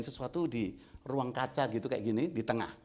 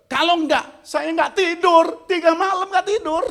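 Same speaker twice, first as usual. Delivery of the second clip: begging to be allowed to bless; desperate pleading; 14 dB louder than usual. Retold or imitated. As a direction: beg like you can't sleep. Man pleading, retold.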